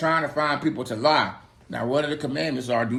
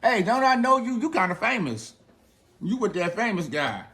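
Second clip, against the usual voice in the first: put-on much higher, teasing girl voice; little girl confronting Peter, high and teasing; little girl's voice; 8 semitones higher than usual. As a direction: poke at him like a nosy little girl. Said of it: "she says to him".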